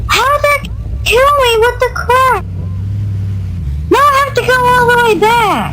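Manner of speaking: high, fast frustrated yelling; whiny gamer rage at dying; raging gamer. Real, not acted. Dies in game, frustrated outburst.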